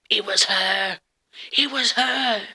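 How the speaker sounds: loud, astonished cry; full-volume cry of amazement; shocked outburst. Retold or imitated. Retold shock at seeing photo.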